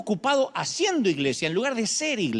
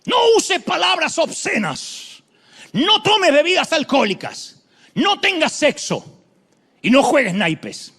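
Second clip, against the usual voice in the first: loud, high mock shouting of the fiery preacher; 8 semitones higher, 7 dB louder, slower than usual. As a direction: bark the rules like a hellfire preacher. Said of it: "él gritaba y decía". (imitando a un predicador gritón)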